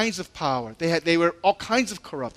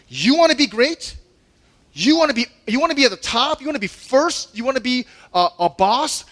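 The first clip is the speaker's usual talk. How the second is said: louder, higher challenging rhetorical questions; 7 semitones higher, 6 dB louder than usual. Retold or imitated. Jesus confronting ambitious disciples, higher louder challenging questions.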